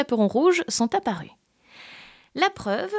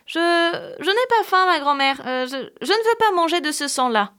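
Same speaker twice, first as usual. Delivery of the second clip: put-on higher, hesitant little girl voice; 7 semitones higher, 4 dB louder than usual. Girl refusing to eat blood, stammering and higher. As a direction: stammer it like a frightened child.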